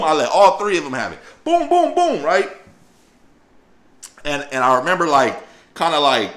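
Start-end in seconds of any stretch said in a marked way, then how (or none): 1.4-2.5 high loud boom for each blow; mimicking punches during the fight; punch sound effects. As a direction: hit every boom like a punch. Acting out punches landing.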